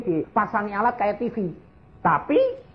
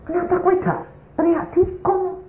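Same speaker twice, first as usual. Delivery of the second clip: high-pitched comic indignation; indignant surprise; 14 semitones higher than usual. Retold or imitated. Husband questioning his wife's cry, indignant surprise. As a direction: protest in a high, incredulous voice. Husband challenges wife, retold quarrel.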